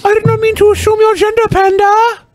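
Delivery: squeaky rushed fake sorry; mock apology. Repeated mock apology, faster.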